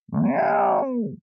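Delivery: very high sharp meow; cat yowls at the window; angry meow. A narrator's reading. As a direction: yowl like an annoyed cat. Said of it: "scuffled Simpkin".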